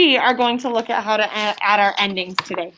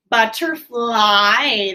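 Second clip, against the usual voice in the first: drawn out; much slower than usual. Shown as a demonstration. Teacher contrasts word sounds.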